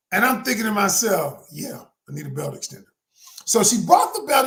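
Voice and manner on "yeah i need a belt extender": muttered thought. Retold or imitated. Inner thought, resigned reply.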